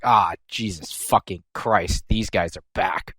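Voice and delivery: low, slow, soft exasperated groan; groaning Blanc. Retold or imitated. Blanc seeing the twins back, low weary groan. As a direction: sag into weary disgust. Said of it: "Blanc is just like".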